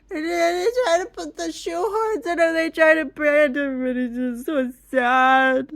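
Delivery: high, loud tearful whine about the horse; hammed-up teary rant; tearful high wail. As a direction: wail it like a kid in tears. Retelling crying over Spirit movie.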